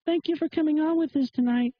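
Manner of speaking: high put-on woman's voice; mocking female radio host. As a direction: imitate a woman host thanking her guest up high. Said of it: "It's like".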